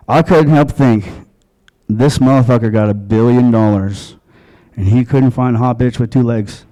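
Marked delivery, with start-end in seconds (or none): none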